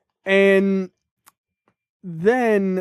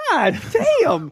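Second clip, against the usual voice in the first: higher, drawn-out, stunned god damn; shocked god damn; 10 semitones higher than usual. Retold or imitated. Podcaster re-enacts his stunned reaction to the husband's insult. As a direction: drag it out in disbelief. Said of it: "I literally said out loud".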